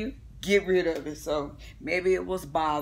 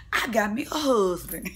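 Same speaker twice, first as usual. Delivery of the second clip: giggling through a mock brag; laughing boast; 2 semitones higher than usual. Kenya laughing as she brags to the girls about marrying. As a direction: laugh while bragging about being married. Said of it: "And I said".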